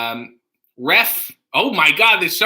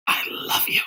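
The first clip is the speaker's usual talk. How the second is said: whispered, softer confession in character; Newman from Seinfeld; 6 dB quieter than usual. Acted. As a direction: whisper it as the sitcom nemesis. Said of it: "is Newman from Seinfeld".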